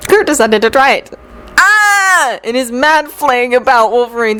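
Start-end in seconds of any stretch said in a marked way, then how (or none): 1.6-2.4 high-pitched "Ah!"; sudden high cry of alarm; startled yell. A narrator's reading. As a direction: yelp as if caught off guard. Cat drops on Wolverine's head.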